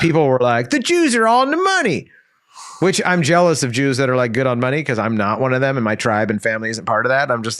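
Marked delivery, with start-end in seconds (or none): 0.7-2.1 mock quote. Mocking what people say.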